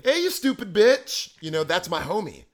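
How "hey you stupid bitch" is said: high, slow mock yell; loud playful insult. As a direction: belt it like teasing a homie. Acted TV greeting.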